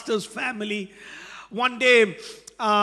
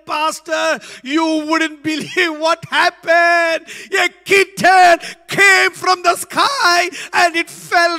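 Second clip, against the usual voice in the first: higher, much louder wonder; mother revealing the kitten, amazed; amazed mom; 7 semitones higher, 8 dB louder than usual. Retold or imitated. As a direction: marvel at the miracle. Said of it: "mom's story climax".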